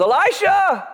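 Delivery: high, sharp cry for help; panicked cry. Acted. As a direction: servant yelling for his master in alarm. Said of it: "his servant turns around and goes".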